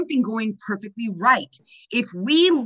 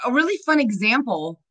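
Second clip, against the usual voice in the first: normal talking voice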